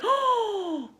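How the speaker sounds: high, drawn-in mock gasp; surprised gasp. Shown as a demonstration. Teacher showing an O-shaped mouth, surprised gasp. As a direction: gasp in exaggerated astonishment. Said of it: "when we're surprised".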